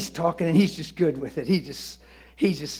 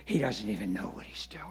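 soft unvoiced whisper; 9 semitones lower, 11 dB quieter, faster than usual. Real, not acted. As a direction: drop to a hushed whisper. Whispered aside.

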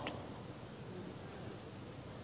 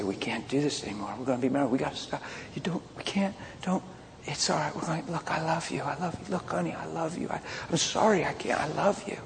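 hushed, broken bits of imitated pleading; soft mock-tearful back-and-forth; retold tearful pleading; 2 semitones higher, 9 dB quieter, faster than usual. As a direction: plead very softly in fragments, as if upset. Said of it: "So I made her cry".

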